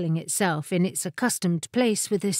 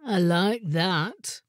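slow, mournful character voice; lugubrious; 2 semitones lower, slower than usual. A narrator's reading. Chair complains as the familiar dozes, slow and lugubrious. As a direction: drag it out in gloomy grievance. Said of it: "someone said lugubriously".

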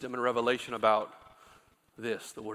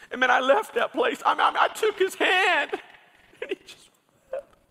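high, loud, impassioned preacher's cry; preaching at full force; 11 dB louder than usual. Acted. Pastor preaching the congregation toward tenderness, loud and high. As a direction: shout it from the pulpit with fire.

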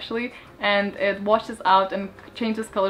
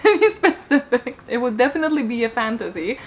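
ordinary delivery

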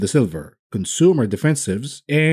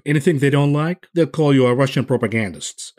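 ordinary talk

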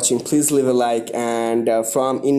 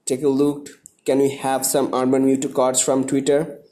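normal talking voice

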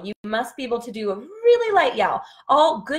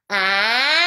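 bright, forward in the face; forward placement; 4 dB louder than usual. Shown as a demonstration. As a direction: float the sound up your face. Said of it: "that's fantastic".

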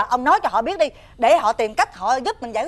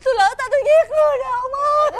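crying confession; 9 semitones higher than usual. Acted. Wife confessing killing, crying.

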